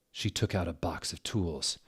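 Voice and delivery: ordinary talk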